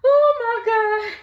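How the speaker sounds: real disbelief at the fight; stunned disbelief. Real, not acted. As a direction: let the shock ring out high and drawn. Reacting to the fight.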